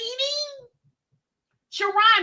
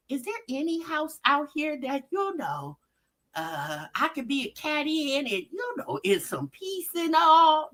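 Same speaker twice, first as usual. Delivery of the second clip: poor kitty cat; 4 semitones lower, 6 dB quieter than usual. Acted. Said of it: "Poor cat was like".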